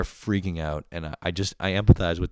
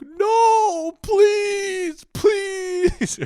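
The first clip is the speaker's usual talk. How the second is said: much higher, louder crying shout; desperate plea; 8 dB louder, much slower than usual. Retold or imitated. Stranded customer losing the lifeline: mock crying shout. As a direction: wail it like someone drowning.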